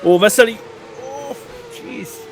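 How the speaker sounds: sudden high startled cry; shocked. Real, not acted. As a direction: gasp it in shock. Shocked by incident.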